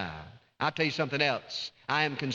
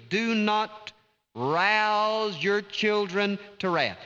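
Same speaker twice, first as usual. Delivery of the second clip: slow, stretched do not rouse, higher than usual; slow emphatic explanation; 5 semitones higher, much slower than usual. Shown as a demonstration. Preacher explaining the verse to fathers, slow and emphatic. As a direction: spell it out slowly and firmly. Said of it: "In other words".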